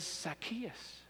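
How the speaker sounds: soft, gentle call of the name "Zacchaeus"; calm kind voice. Acted. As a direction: say "Zacchaeus" tenderly, barely above a breath. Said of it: "hears this calm, kind, sweet voice".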